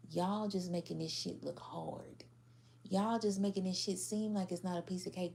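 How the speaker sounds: ordinary delivery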